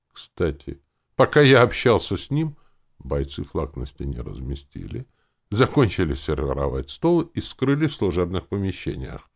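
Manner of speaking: usual speaking voice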